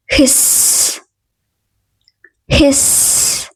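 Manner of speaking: whispered hiss. Shown as a demonstration. Teacher hisses the snake sound for learners, whispered.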